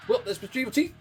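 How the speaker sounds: usual speaking voice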